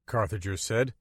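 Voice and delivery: ordinary delivery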